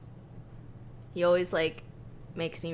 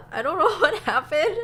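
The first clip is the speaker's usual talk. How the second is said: laughing; 10 semitones higher, 8 dB louder than usual. Real, not acted. Embarrassed at missing the release.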